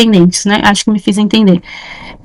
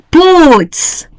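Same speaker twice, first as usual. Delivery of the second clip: putz thrown out higher, with a different voice; exasperated putz; 6 semitones higher than usual. Pretend. Teacher demonstrating Brazilian interjections, exasperated and higher. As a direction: spit out putz like a fed-up exclamation.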